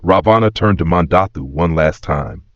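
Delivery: plain reading voice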